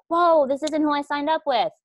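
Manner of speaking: new recruit caught off guard; mock surprise. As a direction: act shocked at what you signed up for.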